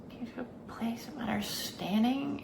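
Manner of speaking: whispered echo, soft and breathy. Shown as a demonstration. Vlogger echoing her own words to the viewer, whispering. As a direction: repeat it as a hushed aside.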